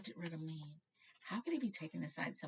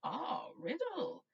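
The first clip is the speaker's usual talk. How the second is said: put-on much higher, slow frail old man; old headmaster; 12 semitones higher, 6 dB louder, much slower than usual. Dippet greeting Riddle, frail and high.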